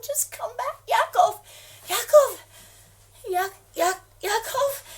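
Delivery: high, loud repeated calling of a name; frantic calling; desperate calling. Acted. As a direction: call his name into the silent phone. Calling into dead phone.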